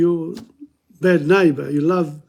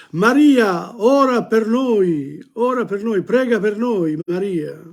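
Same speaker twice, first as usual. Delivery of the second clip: praying souls' cry; 4 semitones higher than usual. Acted. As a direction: call on Mary in mild, lilting supplication. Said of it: "udì gridar".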